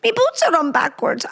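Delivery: high, playful stab at Irish; mock Irish lilt; attempted Irish accent. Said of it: "How do you do Irish?".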